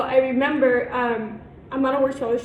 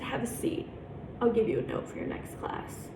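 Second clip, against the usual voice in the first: quiet teacher's order; 9 dB quieter than usual. Retold or imitated. Teacher making a late student stay, quietly controlled. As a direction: drop the volume for a controlled instruction.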